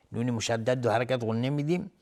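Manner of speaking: normal talking voice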